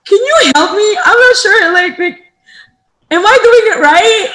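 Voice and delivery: high, loud nervous asking; pleading for help, unsure; anxious plea. Retold or imitated. Owner calling family for help, high anxious voice.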